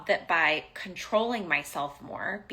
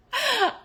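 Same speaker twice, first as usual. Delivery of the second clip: high burst of laughter; 5 dB louder than usual. Real, not acted. Laughs at own anger.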